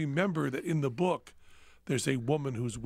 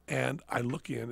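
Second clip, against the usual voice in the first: ordinary delivery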